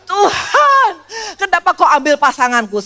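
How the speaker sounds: high, loud, hammed-up wail with a laugh in it; mock sobbing prayer. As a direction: parody a widow crying to God too late. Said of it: "Jangan udah mati baru nangis".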